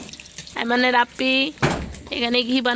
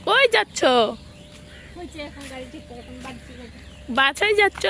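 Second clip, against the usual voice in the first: sing-song call. Real, not acted. Calling after running toddler.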